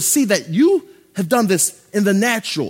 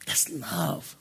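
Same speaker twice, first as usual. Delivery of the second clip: soft whispered conclusion; 4 semitones lower, 12 dB quieter than usual. Real, not acted. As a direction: let it land in a hush. Closing tender point.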